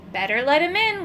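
ordinary delivery

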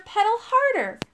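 high, insistent repeated urging; urgent shout. A narrator's reading. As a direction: call it out to spur the rider on. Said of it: "shouted Arthur".

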